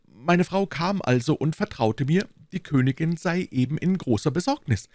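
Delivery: high, fussy voice of the timid landlord; anxious little burgher. Acted. Bonacieux explaining to D'Artagnan, high and anxious. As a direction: play the nervous shopkeeper, pitched well up.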